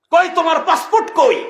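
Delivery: high, loud checkpoint officer; officer's interrogating yell; shouted demand. Acted. Acts officer demanding passport.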